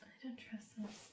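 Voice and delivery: unvoiced wary whisper. Real, not acted. Player whispering suspicion to the table. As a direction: breathe it so only the table hears.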